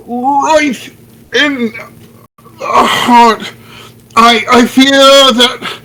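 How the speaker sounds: high, melodramatic dying gasp; having a heart attack. Acted. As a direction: ham up a fake death scene. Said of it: "dramatically clutch my chest".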